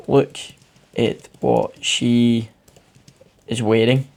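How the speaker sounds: slow, drawn-out mild disgust. Shown as a demonstration. Teacher reading the example quote, slow mild disgust. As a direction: stretch it out with a faint sneer. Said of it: "So the example is".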